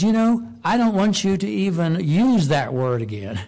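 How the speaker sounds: a preacher voicing God forbidding the word Baal; God's firm command. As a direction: lay down God's order with force. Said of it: "And God says".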